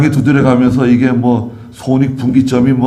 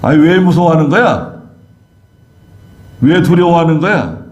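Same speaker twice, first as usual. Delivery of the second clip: higher, exasperated, nagging questions; exasperated scolding; 5 semitones higher than usual. Acted. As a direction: scold them for their fear. 제자들을 나무라는 예수님 재연. (제자들을 다그치는 답답한 말투)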